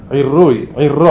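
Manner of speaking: עירוי and עירו with a doubled, rolled r; dagesh in the resh. Shown as a demonstration. Teacher citing Mishnaic Hebrew, doubled resh in עירוי. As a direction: hold the ר in עירוי. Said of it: "וגם ברש אנחנו יכולים למצוא".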